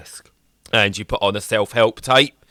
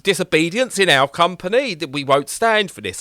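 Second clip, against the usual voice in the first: mock outraged executives; 8 semitones higher than usual. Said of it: "And they're like".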